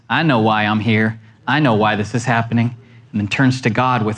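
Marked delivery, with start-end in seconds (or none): 0.1-2.7 low, settled and certain; confident victor. Retold or imitated. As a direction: say it like a hero who knows his purpose. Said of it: "a victor says".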